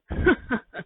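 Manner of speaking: a short, loud laugh; burst of laughter. Acted. Pimpich laughing at the listener's confession with a loud burst. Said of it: "bursting into laughter".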